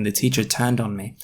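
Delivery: ordinary delivery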